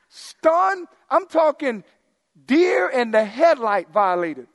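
Stunned punched high and loud, then slow; emphatic stress on stunned. Real, not acted. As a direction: hit Stunned hard and stretch the rest. Stressing how stunned they'll be.

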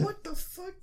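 high squeaked what the fuck; squeaky mock outrage. Retelling his reaction to the manager, squeaky and outraged. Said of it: "So I'm like".

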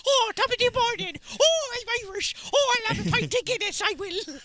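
high, loud, hammed-up stage Irish; badly faked Irish, way up high; dreadful Irish accent. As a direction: squeal out a terrible Irish caricature. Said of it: "best I could do for an Irish accent".

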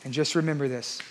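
soft altar call. Acted. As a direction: speak it close and gentle, like a final plea. Soft closing appeal.

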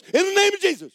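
very high, loud command; shouted rebuke. Retold or imitated. Man to snake coiled round him, shouted rebuke. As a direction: drive it off with a shout.

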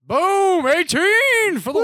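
high, drawn-out hollered cheer; mock-triumphant high holler; celebratory cheer. Real, not acted. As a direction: whoop it up like a big win. Celebrates the very low score.

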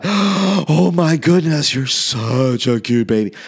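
put-on high, slow, gushing coo at a baby; gushing baby-lover. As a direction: melt over the baby, drawing every word out. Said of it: "that person who goes by".

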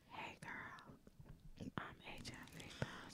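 barely audible seductive-style whisper. Acted. Man slipping a confession to a drunk date, whispered. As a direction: whisper it, almost no voice at all. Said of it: "you whisper that shit like".

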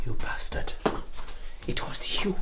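seething, unvoiced accusation; furious whisper. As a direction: spit the betrayal out in a whisper. Accuses friend of being informer.

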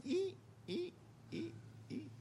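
tiny high squeaks; soft, high-pitched squeak sound effect; creaking lantern. Retold or imitated. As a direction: imitate a swinging lantern squeaking softly. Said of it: "a little lantern going".

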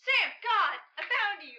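high, loud cry on finding a friend; urgent relieved shout. Acted. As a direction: call out in frantic relief. Finds runaway friend in store.

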